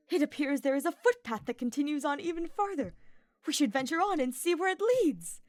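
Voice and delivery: high, bright, thrilled nobleman; excited young knight. Acted. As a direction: gush over the view breathlessly. Ferdinand's voice again.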